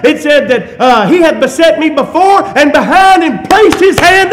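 loud, high fervent preaching; a preacher crying out to the congregation; preaching at full force. Real, not acted. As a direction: lift it up and proclaim it.

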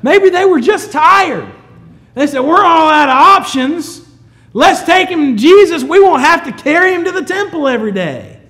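loud, high, driving preacher's voice; full-force preaching. Real, not acted. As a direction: preach it out to the whole congregation.

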